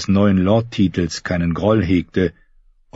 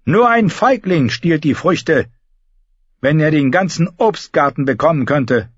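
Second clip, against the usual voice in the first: lightly raised contempt; scornful; 7 semitones higher than usual. A narrator's reading. Euron scorning the council, lightly raised. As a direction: sneer at their small ambition.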